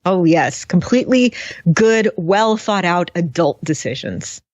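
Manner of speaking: mock agreement, slowing over the praise; sarcastic. Real, not acted. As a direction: agree with exaggerated, deliberate conviction. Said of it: "We are being very sarcastic".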